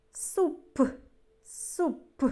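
"Суп" with a gap before a popped P; emphasized P sound. Shown as a demonstration. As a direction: release the last П of "Суп" as a distinct puff. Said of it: "щоб добре було чути звук П".

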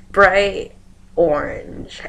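ordinary talk, nothing marked

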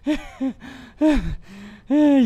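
high, loud burst of laughter. Real, not acted. Laughs after winning.

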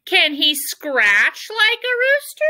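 high, challenging hen voice; bossy hen. Acted. Bossy hen testing the owl, high challenging voice.

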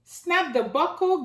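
usual speaking voice

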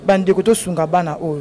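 usual speaking voice

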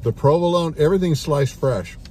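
usual speaking voice

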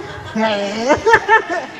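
real chuckle at own joke; laugh. Real, not acted. Comic laughing with the crowd at the joke, amused chuckle. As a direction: laugh along after the punchline.

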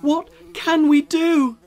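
high, loud desperate cry; a crowd wailing for help; villagers crying out. A narrator's reading. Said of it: "the villagers cried".

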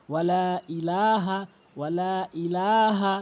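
"ওয়ালা ইলাহা" slow, with laa held long; long drawn vowels. Shown as a demonstration. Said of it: "এভাবে উচ্চারণ করবেন".

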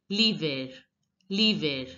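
l'hiver as "lee-vair", said twice; l'hiver repeated, falling, with r heard; sounded final r. Shown as a demonstration. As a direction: keep the r at the end of l'hiver. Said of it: "here we are going to pronounce it as".

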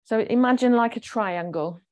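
ordinary talk, nothing marked

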